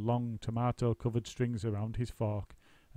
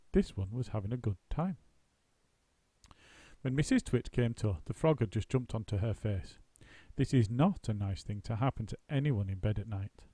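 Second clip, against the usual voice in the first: ordinary delivery, nothing marked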